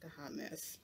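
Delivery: real whisper to oneself; whispered aside. Real, not acted. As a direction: drop to a hushed, self-critical aside. Muttered aside about own look.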